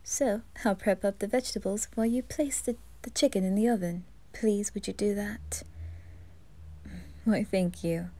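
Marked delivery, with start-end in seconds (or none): 4.3-5.6 soft whispered plea; whispered aside. Acted. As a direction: breathe the request. Unvoiced whispered request.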